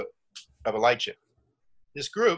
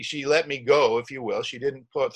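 ordinary talk

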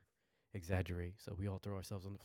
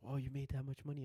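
mildly amazed, pitched up; imitating impressed friend; 6 semitones higher, 3 dB louder than usual. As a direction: react to big news on a higher note. Acts out friend's amazed reaction.

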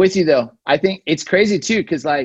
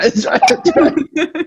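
infomercial hype; 9 semitones higher, 5 dB louder, slower than usual. Pretend. Playing along with infomercial.

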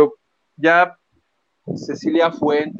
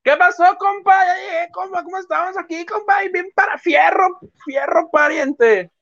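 put-on northern Mexican accent; 14 semitones higher than usual. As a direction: play a northern Mexican compa, pitched way up. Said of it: "el plebuelo".

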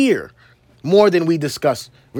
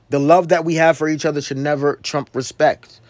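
ordinary delivery

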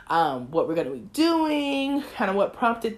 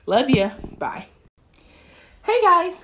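normal talking voice

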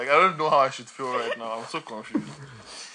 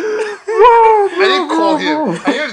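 wailing through laughter, much higher and louder; real laughter breaking the words; laugh-crying; 12 dB louder, much slower than usual. Real, not acted. Teased guest wailing his denial while laughing hard.

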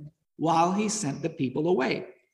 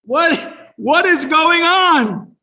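a higher, louder, slowed cry of confusion; startled disbelief; 9 semitones higher, 9 dB louder, much slower than usual. Retold or imitated. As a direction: sound stunned, drawing out what is going on. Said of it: "They're like".

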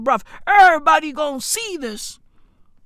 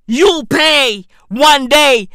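slow, drawn-out menacing line; slow hammed-up menace; slow ominous threat; 4 dB louder, slower than usual. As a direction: drag each word like doom. Mocking threat, slowed.

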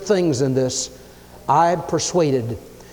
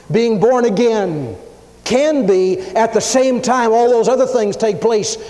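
emphatic preaching; 6 semitones higher, 4 dB louder than usual. Acted.

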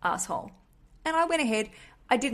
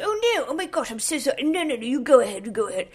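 flustered posh gentleman, high and apologetic; hammed-up aristocratic fluster, pitch leaping up; Prince Philip; 4 semitones higher, 5 dB louder, faster than usual. Appalled posh man caught out in the queue, flustered apology. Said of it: "he sounds like Prince Philip".

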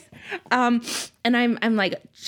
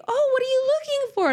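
high, sweet, chirpy mom voice; hostess's mom; faster than usual. Retold or imitated. Mother telling the story of a party, voicing the hostess's mom in a high, chirpy voice. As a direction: play a cheerful, oblivious mother, pitched up high. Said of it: "the hostess's, uh, mom is like".

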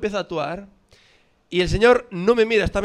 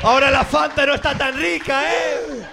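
higher, louder playful shout; loud joking call; 8 semitones higher, 5 dB louder, slower than usual. Real, not acted. Friend calling out loudly about the Fanta, playful. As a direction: throw it out loudly to the room.

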